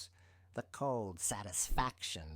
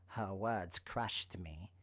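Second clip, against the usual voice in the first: usual speaking voice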